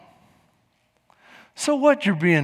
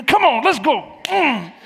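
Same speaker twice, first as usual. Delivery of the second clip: high pumped-up cheer; church hype; 5 semitones higher, slower than usual. Retold or imitated. Preacher imagining church hyping each other, excited cheer. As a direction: cheer them on like a teammate. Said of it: "coming to church and we".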